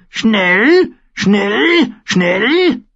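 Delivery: high, repeated raven squawks; loud rasping bird cries; croaking raven. A narrator's reading. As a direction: squawk each word separately, rising. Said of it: "krächzte der Rabe".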